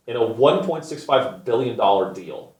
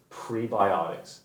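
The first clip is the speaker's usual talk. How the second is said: slow, softer, drawn-out scorn; disgusted; 5 semitones lower, 10 dB quieter, slower than usual. Real, not acted. Repeating the product word with slow disgust. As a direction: say it like a dirty word.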